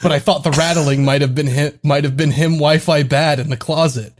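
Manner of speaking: fast read of the texts; reading messages aloud. Real, not acted. Reading a friend's texts aloud on the podcast, fast. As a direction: rattle the messages off quickly. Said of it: "He just messaged me".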